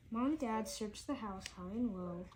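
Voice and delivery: ordinary delivery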